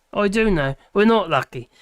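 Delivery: ordinary delivery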